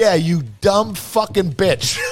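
slow, drawn-out scornful curse; exasperated insult. Retold or imitated. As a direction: drag out the insult in disbelief. Angry at niece in Everglades.

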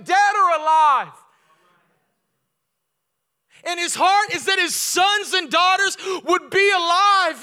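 high, loud preacher's cry; loud high impassioned preaching; preaching at full force. Acted. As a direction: build it to a climax. Preaching climax.

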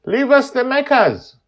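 high, loud shout. Acted. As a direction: yell it after her. Shouting after closing door.

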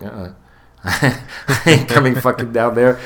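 cocky laughing taunt from the pillar; laughing defiance. Retold or imitated. Defiant protester refusing cop.

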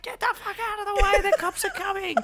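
mock yell. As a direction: shout the fans out of the way. Band yelling at fans.

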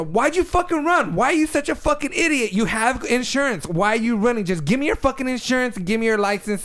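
high-pitched mock rage; furious yelling. Girlfriend confronting the hit-and-run driver, mock furious yell. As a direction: yell it at the driver who fled. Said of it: "so I'm Melissa".

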